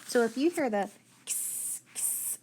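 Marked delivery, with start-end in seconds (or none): 1.2-2.4 KS as a bare voiceless hiss; crisp, separated "ks", no vowel; isolated letter sound. Shown as a demonstration. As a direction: say just the "ks" sound, twice. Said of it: "sound, what do you think".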